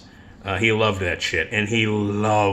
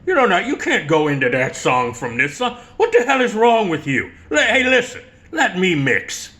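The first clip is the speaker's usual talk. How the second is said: rising, louder mock rant, pitch climbing high; 8 semitones higher, 4 dB louder than usual. Joe yelling at a club DJ for bad mixing, heated rant. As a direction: scold the DJ, getting higher and louder. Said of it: "yell at the D J".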